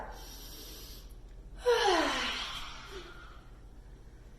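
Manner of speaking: drawn-out airy whoosh of breath; long breathy exhale. Shown as a demonstration. Teacher leading a circle breath, long whooshing exhale. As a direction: blow the breath out slowly. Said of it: "Take a big breath".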